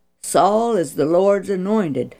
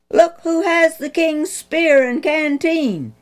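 loud, much higher accusing shout; 9 semitones higher, 4 dB louder than usual. Acted. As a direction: rebuke the captain from the hilltop. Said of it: "David shouted".

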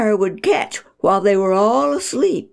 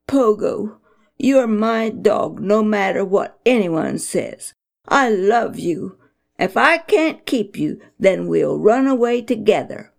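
ordinary delivery, nothing marked

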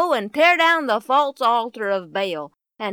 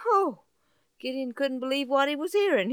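plain reading voice

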